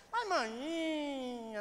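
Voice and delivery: long, high Northeastern Brazilian sing-song; imitating a pleading Northeastern Brazilian daughter, loud and drawn-out; Northeastern Brazilian wheedling. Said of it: "naquele jeito nordestino".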